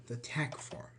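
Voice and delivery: breathy aside whisper. Real, not acted. Teacher whispering the term to the class.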